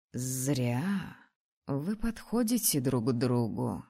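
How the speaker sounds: low, soft wise dragoness; deep-set calm dragon voice. Acted. Dragon Frida speaks.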